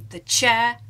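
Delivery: usual speaking voice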